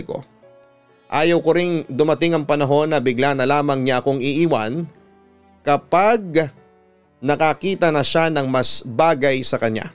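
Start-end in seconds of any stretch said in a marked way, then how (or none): none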